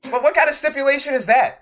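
high, baffled question; mock the silly rule in disbelief; incredulous. Real, not acted. Mocking the stipulation.

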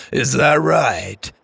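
chuckle, then slow scepticism; amused laughing. A narrator's reading. Driver humours Lainey's alien claim, amused and laughing. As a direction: laugh it off, then draw out the question. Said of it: "went back to chomping his gum and laughed".

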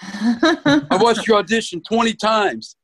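pitched high, a friend's thrilled phone call retold; retold gushing excitement. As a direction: gush like the director who loved the audition. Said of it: "He says".

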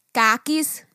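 loud, mock Boston accent on "Khakis"; Boston mom. Retelling the Boston mom TikTok, imitated Boston accent. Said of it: "asking her to pronounce the words".

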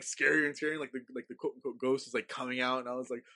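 ordinary talk

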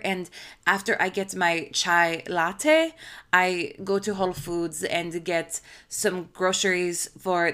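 imitated vague foreign accent; halting non-native English accent; Lindsay Lohan. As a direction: talk in a put-on accent, as if English were a second language. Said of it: "I'll try to imitate it".

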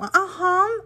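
"aham" stretched slowly with an American lilt. Shown as a demonstration. Contrasting it with the Brazilian one, in a slow American accent.

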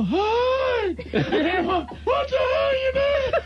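high laughing shout at a worker; Joe impression. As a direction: yell it way up high like an angry boss. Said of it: "he'd go".

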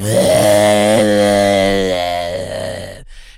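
imitated raspy laugh. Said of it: "I just like hearing her going".

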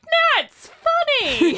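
high, loud, drawn-out mock wail; exaggerated, stretched-out protest; retold protest. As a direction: whine it out slowly at full pitch. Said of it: "and I'd be like".

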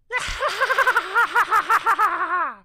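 giggly sinister sing-song cackle, high and loud; evil clown laugh. Pretend. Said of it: "do our own evil clown laugh".

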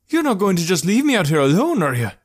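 high, loud, indignant plea; panicky protest. A narrator's reading. Traveller to departing rescuer, protesting in alarm.

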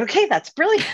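high, loud mock eureka; retold delight. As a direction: exclaim over a friend's clever idea. Retold delight at friend's idea.

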